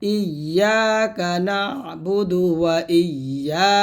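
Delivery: slow chant, long held ending; drawn-out tajweed recitation; model recitation. Shown as a demonstration. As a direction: recite with doubled y and stretched vowels. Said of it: "এরকম".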